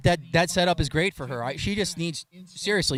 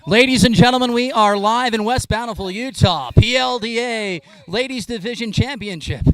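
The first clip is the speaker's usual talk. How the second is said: booming stage introduction, higher and louder; announcer voice; 5 semitones higher, 8 dB louder than usual. Pretend. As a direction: ring it out like a live event announcer. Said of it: "do we need to use the announcer voice".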